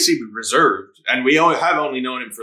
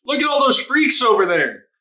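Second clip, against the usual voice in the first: voiced much higher, a sneering onlooker pointing at the crowd; a high, jeering townsperson; mocking bystander; 11 semitones higher than usual. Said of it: "Just straight up".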